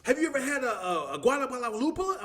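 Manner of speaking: high, pushy quizzing; eager questioner. Retold or imitated. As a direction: press him like a know-it-all. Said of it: "it's like".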